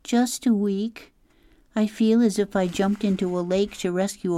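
put-on an upset girl listing what they'd lose; a hint of a fretful young girl; Claudia, upset girl.

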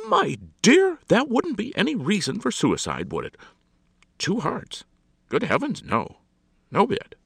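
high, loud, amused-sounding scoff; society hostess, dismissive. Acted. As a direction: brush the idea off emphatically.

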